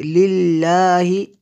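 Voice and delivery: लिल्लाहि chanted with a light doubled L; Tajweed लिल्लाहि, light lam; thin, light L. Shown as a demonstration. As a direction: recite लिल्लाहि with the L kept thin, long aa. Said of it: "इसको बारीक पढ़ेंगे".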